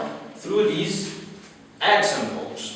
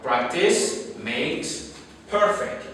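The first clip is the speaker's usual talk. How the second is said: slow, deliberate saying; slower than usual. Shown as a demonstration. Teacher repeating a proverb slowly to students. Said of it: "Don't forget".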